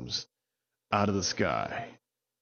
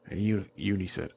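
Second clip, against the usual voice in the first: ordinary delivery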